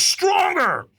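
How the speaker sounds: full-throated high yell right in the face; screamed. Retold or imitated. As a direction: scream it as a trainer in someone's face. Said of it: "then he screamed at me".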